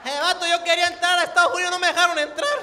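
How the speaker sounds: put-on high, loud, fast slurred Arabic-like accent; hammed-up Arabic-tinged coastal accent; mock Arabic-sounding costeño. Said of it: "Se vuelve como árabe".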